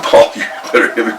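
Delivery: retold taunt through a brief laugh. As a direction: laugh it off, daring him to hit. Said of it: "So I actually laughed".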